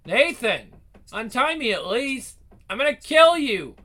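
rising from a call into a furious yell; angry shout. Acted. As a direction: build from calling to screaming threats. Tied up, yelling for Nathan.

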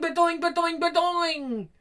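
high, bouncy ba-doing call; high catchphrase chirp. Acted. Bobby chirping his catchphrase. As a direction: chirp it like a cartoon sound.

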